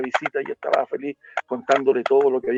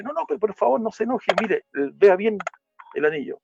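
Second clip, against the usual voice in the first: put-on high, quick pleading child voice; child begging the man to calm down, high and fast; 7 semitones higher than usual. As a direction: beg him urgently to look. Child begs man not be angry.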